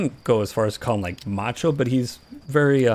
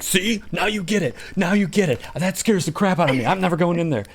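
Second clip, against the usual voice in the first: vindicated scared friend; 6 semitones higher than usual. Retold or imitated.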